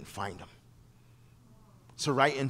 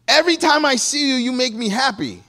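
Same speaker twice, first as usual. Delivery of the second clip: much higher and louder, arms-up delight; gushing joy; 10 semitones higher, 9 dB louder than usual. Retold or imitated. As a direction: burst out with overjoyed praise. Husband, hands up, gushing.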